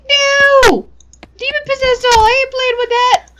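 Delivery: high, loud freaked-out refusal. Real, not acted. Refusing the possessed doll.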